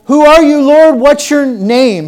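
high, loud pleading cry; desperate plea. Acted. Acting out Saul's desperate plea.

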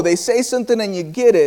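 usual speaking voice

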